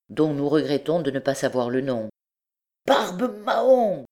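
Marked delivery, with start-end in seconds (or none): 2.9-4.0 high, drawn-out cry; slow, high-pitched exclamation; shouted oath. A narrator's reading. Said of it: "cria Trouillefou".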